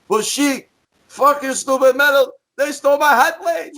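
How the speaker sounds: high-pitched mock wail; hammed-up tearful complaint in a put-on voice; Nikolai. Wrestler answers roommate after the burglary, imitated high wail. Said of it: "Nikolai said he's crying, tears rolling down his eyes".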